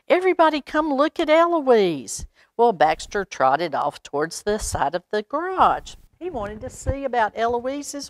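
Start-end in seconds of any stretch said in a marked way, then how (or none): 0.1-2.3 high, fast eager shout; excited call. A narrator's reading. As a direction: call everyone over, thrilled. Boy calls family excitedly.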